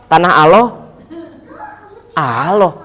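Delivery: put-on Kabayan's Sundanese accent. Kabayan answering whose land it is, Sundanese accent. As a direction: answer simply in a Bandung accent. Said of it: "dialog [name] sama Didi Petet yang jadi Kabayan".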